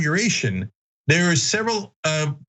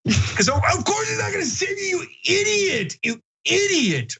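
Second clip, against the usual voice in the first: mocking exasperation. Real, not acted. Host ridiculing senators who expected Trump to save them, mocking squeal. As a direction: jeer in a high, incredulous squeal.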